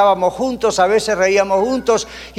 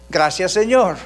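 ordinary talk

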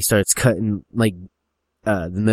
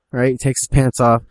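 ordinary talk

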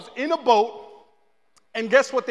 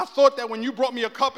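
normal talking voice

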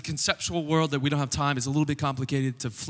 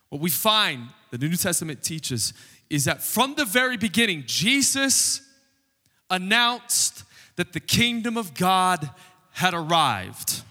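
emphatic preaching; 4 semitones higher than usual. Real, not acted. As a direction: proclaim it to the congregation, leaning hard on key words. Preaches the arrival of God's kingdom.

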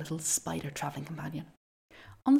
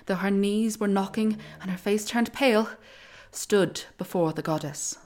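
louder, building epic narration; dramatic storyteller; 2 semitones higher, 5 dB louder than usual. A narrator's reading. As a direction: tell the reveal with awe.

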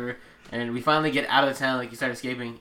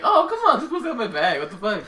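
mock outrage; 9 semitones higher, 5 dB louder than usual. Mocking his own frustration.

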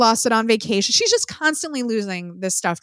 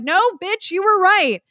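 sassy retort; 9 semitones higher, 4 dB louder than usual. Retold or imitated. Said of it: "And it's like".

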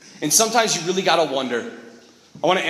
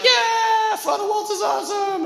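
put-on high squealing kids cheering; excited kids. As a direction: shout it much higher like cheering kids. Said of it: "Kids were like".

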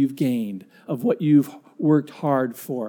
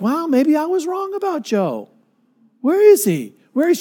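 high, softened wife voice; she's pleasantly caught off guard; surprised wife; 9 semitones higher than usual. Retold or imitated. Wife sees the half-painted house, high surprised voice.

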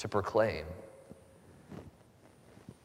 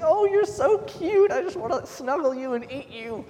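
high-pitched dog voice; 5 dB louder than usual. Shown as a demonstration. Pastor imitating how he talks to his dog, high baby talk. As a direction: coo at your dog like a baby. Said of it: "I talk in a really high-pitched voice".

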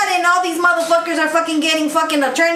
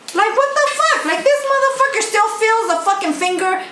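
incredulous; 7 semitones higher than usual. Real, not acted. Incredulous rant.

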